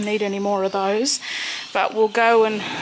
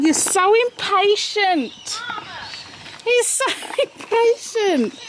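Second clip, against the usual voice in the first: laughing, exasperated; 8 semitones higher than usual. Real, not acted. Laughing at the eager horse.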